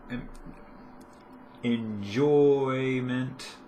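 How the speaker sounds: enjoyment, drawn out slowly; slow self-correction. Shown as a demonstration. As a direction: stretch out enjoyment as you fix the word. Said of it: "Words, guys".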